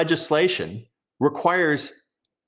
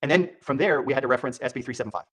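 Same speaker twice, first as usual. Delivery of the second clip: usual speaking voice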